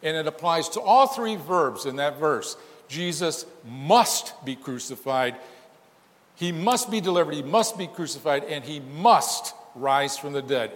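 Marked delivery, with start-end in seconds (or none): 2.9-10.7 must hit hard each time; emphatic must, driven home; stress on must. Shown as a demonstration. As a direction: pound the word must in every line.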